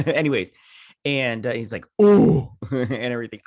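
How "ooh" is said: loud, high drawn-out "ooh"; mad "ooh". Retold or imitated. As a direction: imitate a frustrated, mad "ooh". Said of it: "I do that when I get mad".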